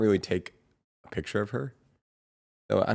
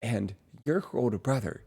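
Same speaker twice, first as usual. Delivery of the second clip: lightly voiced bouncy friend, higher; a hint of an upbeat friend; 6 semitones higher than usual. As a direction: imitate her bouncy cadence. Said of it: "how did she say it".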